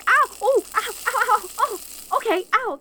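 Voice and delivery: pained yelps. Acted. Grabs silver-imbued cage bars.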